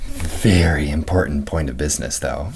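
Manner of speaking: mock-secret whisper. Host teasing the next segment, whispering.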